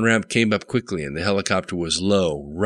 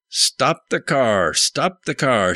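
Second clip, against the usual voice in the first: slow, higher shouted command; 7 semitones higher, slower than usual. A narrator's reading. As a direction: shout it as a stern order. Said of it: "shouted the policeman".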